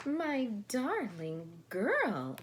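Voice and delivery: slow, loud call; grandmother calling. Acted. Babushka calls to her crying granddaughter, slow and loud. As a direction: call out gently from afar. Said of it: "a voice called out".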